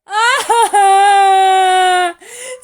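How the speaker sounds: high, loud shouted sob; loud wail. A narrator's reading. Sun howling after the crow's insult, loud sobbing. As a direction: howl with crying.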